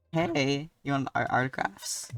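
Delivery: ordinary talk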